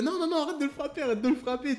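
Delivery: high, quick, loud pleading in mock alarm; imitated amused protest. Neighbour telling father to stop hitting, imitated amused protest. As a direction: protest as if trying not to laugh. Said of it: "tout en se marrant".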